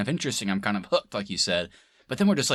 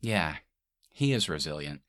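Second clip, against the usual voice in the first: smiling deadpan; 5 semitones lower, 4 dB quieter than usual. Retold or imitated. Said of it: "she'll just smile and be like".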